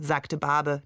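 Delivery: plain reading voice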